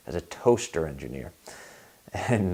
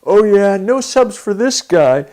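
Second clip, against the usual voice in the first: loud, much higher mocking jeer at a coworker; in-your-face taunting; 10 semitones higher, 12 dB louder than usual. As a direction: get in his face and rub it in. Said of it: "gets right in my face".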